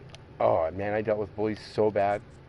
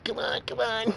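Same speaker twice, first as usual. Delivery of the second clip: very high, urgent mock pleading; hammed-up high-pitched begging; Cookie Monster impression; 12 semitones higher than usual. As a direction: imitate a desperate addict urging it on. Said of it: "a little bitty lighter going".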